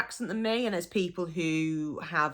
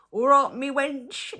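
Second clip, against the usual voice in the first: slow, louder friendly "All right, me wench"; affectionate greeting; 8 semitones higher, 6 dB louder than usual. Shown as a demonstration. Greeting another woman, slow and affectionate. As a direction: greet a friend warmly, drawn out. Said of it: "affectionate terminology".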